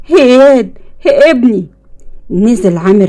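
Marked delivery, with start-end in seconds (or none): 0.1-1.7 higher-pitched cry of alarm; terrified. A narrator's reading. Father finding his son in the pool, terrified. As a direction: call out to the child in horror. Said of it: "اتسمر بمكانه برعب وقال".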